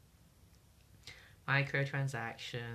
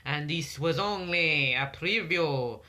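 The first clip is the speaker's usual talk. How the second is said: put-on French accent; 3 dB louder than usual. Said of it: "stop doing that accent".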